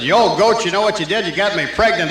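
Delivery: put-on loud and high, scolding her old husband; angry yell. Old wife yells at husband down the phone, loud and high. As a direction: blast it down the phone at him.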